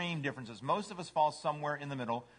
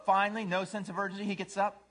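ordinary delivery

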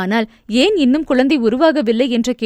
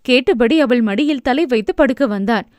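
usual speaking voice

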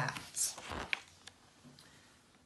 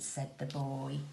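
ordinary delivery

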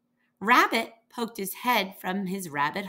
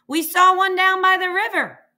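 calling out; 9 semitones higher, 9 dB louder than usual. A narrator's reading. Goats calling down to moose.

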